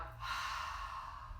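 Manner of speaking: long, soft, audible exhale through the mouth; slow breath out. Shown as a demonstration. Teacher showing kids how to breathe out, long soft exhale. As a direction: let the air go out slowly and audibly. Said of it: "And breathe out".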